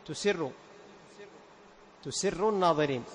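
تسر said tusirru, damma on ta and kasra on sin; demonstrating the mistake تسر as tusirru; wrong tusirru. Shown as a demonstration. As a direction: recite تسر as tusirru to show the error. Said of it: "يضم التاء ويكسر السين".

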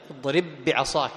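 "اضرب بعصاك" read slowly, with the two b sounds merged into one long doubled b; merging the two b's. Shown as a demonstration. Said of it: "تدغم الباء في الباء".

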